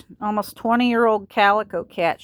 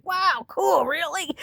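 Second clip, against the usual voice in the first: high-pitched mock amazement; excited child reaction; 9 semitones higher, slower than usual. Retelling her answer to dad's hyena claim, excited. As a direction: replay the wonder in a raised voice. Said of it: "And I was like".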